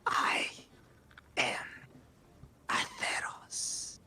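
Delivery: whispered, high demonic entity. Acted. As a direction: breathe it like an ancient demon. Demon voice held.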